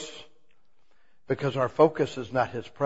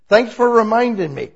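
louder, higher mock gratitude; cheery thank-you; 6 semitones higher, 6 dB louder than usual. Shown as a demonstration. Preacher acting out thanking the devil, louder and higher. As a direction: act it out, a bit louder and brighter.

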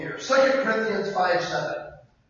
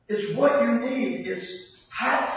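ordinary delivery

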